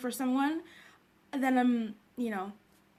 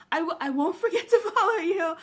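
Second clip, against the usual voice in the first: louder, laughing promise; 3 semitones higher, 7 dB louder than usual. Real, not acted. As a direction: say it through a real laugh. Laughs while promising to follow.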